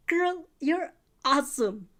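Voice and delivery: emphatic hyping. A narrator's reading. Friend insists doubter is awesome, emphatically. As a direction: draw out every word to convince.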